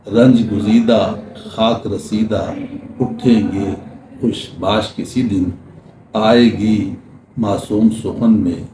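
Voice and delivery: measured, lingering poetry reading; ghazal recital. Acted. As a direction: recite the ghazal line by line, letting each refrain fall. Ghazal continues.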